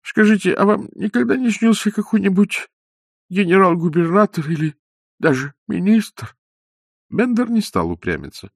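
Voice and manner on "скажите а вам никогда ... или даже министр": put-on eager old man's question. Old man eagerly asking.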